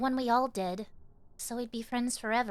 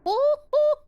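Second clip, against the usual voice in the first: very high, loud squeal; excited squeal; 11 dB louder than usual. Real, not acted. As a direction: yelp at the sudden sight. Startled by game monster.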